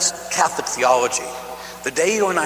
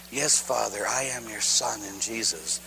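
much softer, low and reverent; whisper-soft reverent telling; hushed surrender; 6 semitones lower, 10 dB quieter than usual. Retold or imitated.

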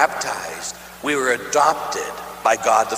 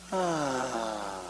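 very soft satisfied sigh; contented soft sigh; 3 semitones lower, 13 dB quieter than usual. Retold or imitated. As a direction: sigh as if safely held.

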